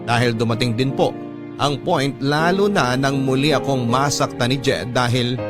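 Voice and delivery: ordinary talk, nothing marked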